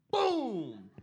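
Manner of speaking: high booming crash noise, falling away; explosive, drawn-out sound effect; imitated impact. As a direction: punch out the moment of impact. Imitates the truck hitting him.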